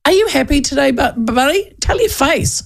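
high mocking jab at a glum face; high, joshing barista voice; teasing cafe guy. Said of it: "here's the other thing".

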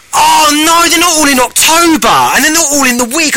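high, exclaimed retold dismay; mock dismay. Host realising guests aren't in October, high mock dismay. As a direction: act out your own disappointed realisation, pitched high. Retelling own dismay at dates.

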